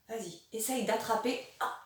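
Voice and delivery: normal talking voice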